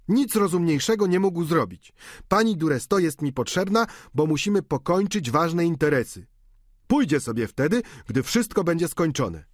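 servant's report. Acted. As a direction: rattle off the report.

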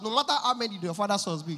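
ordinary talk, nothing marked

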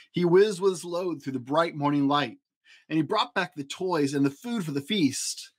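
usual speaking voice

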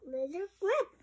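plain reading voice

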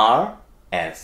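ordinary delivery

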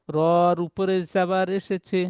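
usual speaking voice

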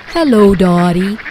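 put-on little quail voice, not truly whispered; stagey greeting from a shy quail; whispering quail, voiced aloud. A narrator's reading. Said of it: "whispers the quiet quail".

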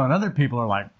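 usual speaking voice

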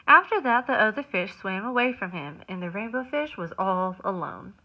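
lively storybook narration; reading to children. A narrator's reading. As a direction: read it like circle time. Reads picture book aloud.

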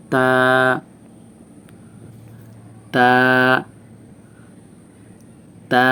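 "তা" with tongue tip on the gum behind upper teeth; teacher's slow, long "তা"; demonstrated articulation. Shown as a demonstration. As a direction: tap the inner gum, then draw "তা" out long. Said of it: "ঠিক ওই মাড়ির ওইখানে জিহ্বার আগাটা লাগবে".

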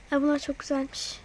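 ordinary talk, nothing marked

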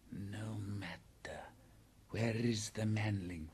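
slow, hushed snake; python voice. A narrator's reading. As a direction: stretch the words out softly, like a python. Python answers the bear.

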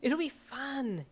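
high, slow, singsong coaxing; cajoling. A narrator's reading. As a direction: wheedle it, stretching the last word. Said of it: "you cajoled".

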